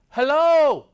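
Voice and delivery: loud, high hello in imitation; booming greeting. Lone drinker hails a passing guest, booming greeting. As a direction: call out a big, high hello.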